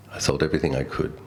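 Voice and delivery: ordinary talk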